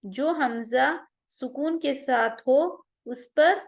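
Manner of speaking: ordinary talk, nothing marked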